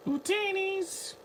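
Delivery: high, slow Jawa squeak; imitating a Jawa. Mimicking Jawas with a high, slow squeak.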